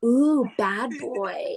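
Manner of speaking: slow, drawn-out teasing coo; mock flirty tease. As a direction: purr it like a fake flirt. Teasing the creep over DM.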